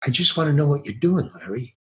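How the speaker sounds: soft, imitated director's aside; private aside. Director reassuring his actor privately, acted in a soft aside. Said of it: "They talk to you aside".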